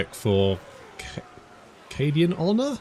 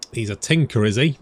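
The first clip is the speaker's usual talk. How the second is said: ordinary delivery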